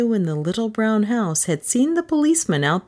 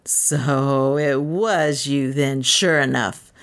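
slow, low gloating; self-satisfied policeman; 5 semitones lower, slower than usual. A narrator's reading. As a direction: savour the catch slowly, pitched low. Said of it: "delighted with his own cleverness".

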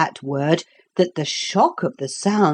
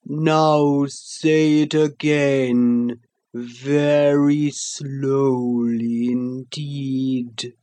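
sleepy creature voice; much slower than usual. Acted. The Sloth's voice.

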